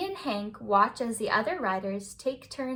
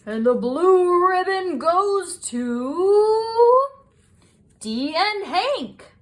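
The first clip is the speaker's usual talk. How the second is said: slow, loud, higher sing-song arena call; booming announcer naming the winners to the crowd; loudspeaker announcer; 7 semitones higher, 9 dB louder, slower than usual. Acted. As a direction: stretch it out like a rodeo announcer over the PA. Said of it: "The announcer's voice comes over the loudspeaker".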